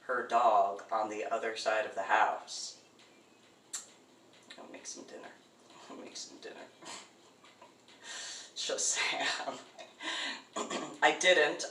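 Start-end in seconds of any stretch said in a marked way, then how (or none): 4.6-6.5 menacing whisper; whispered threat. Retold or imitated. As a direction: breathe it like a sinister promise. Threat to cook the dog.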